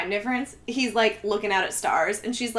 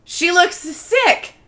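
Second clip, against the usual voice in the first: blunt cowboy remark; 7 semitones higher, 8 dB louder than usual. Retold or imitated. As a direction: sneer out the glue factory joke like a gruff rancher. Ranch man's blunt quote, acted.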